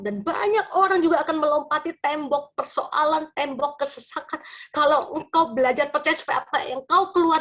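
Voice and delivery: high, swelling preacher's proclamation; impassioned preaching. Real, not acted. Preacher urges congregation that their testimony makes others believe, impassioned preaching. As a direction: drive the promise home, building to a loud peak. Preaches the promise at full force.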